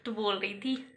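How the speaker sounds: normal talking voice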